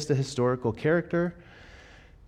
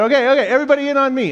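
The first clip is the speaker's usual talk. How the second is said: fast, high, loud call to gather; calling the team; 13 semitones higher, 8 dB louder, much faster than usual. Retold or imitated. Coach calls six-year-olds into a huddle, fast and loud. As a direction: rattle it off and call everyone in.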